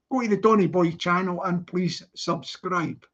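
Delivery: ordinary delivery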